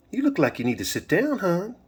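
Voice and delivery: high-pitched, sing-song Southern lilt; Southern twang. A narrator's reading. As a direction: tempt him in a high Southern voice. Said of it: "that beautiful Southern twang".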